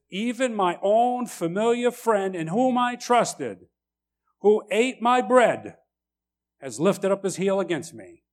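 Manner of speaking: preacher reads the verse aloud, slow and lifted; scripture reading. Acted.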